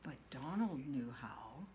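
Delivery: a secretive narrated whisper. A narrator's reading. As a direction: breathe it like a secret. Sly narration aside.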